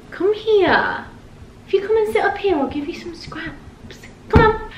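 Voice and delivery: high coaxing pet voice; talking to a dog. Real, not acted. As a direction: coax the dog up onto the couch.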